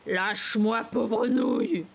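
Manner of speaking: loud raucous voice, slowing down; hoarse, spitting rage. A narrator's reading. Sister pulling free of brother, hoarse spitting rage. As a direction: spit it out in a strange hoarse voice. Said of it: "Sa voix bizarrement rauque".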